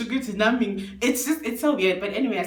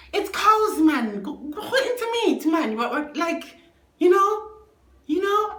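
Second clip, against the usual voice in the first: excited; 8 semitones higher than usual. Real, not acted. Giddy over his whispering.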